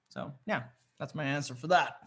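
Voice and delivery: normal talking voice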